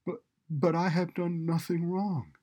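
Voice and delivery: high, stammering desperate protest; emphatic protest. A narrator's reading. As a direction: plead your innocence emphatically. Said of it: "the man emphatically protests again".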